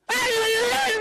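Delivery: high, loud mock-wife retort; wife shouting back. Wife answering husband in a quarrel, high shouting. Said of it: "Istri saya juga bilang".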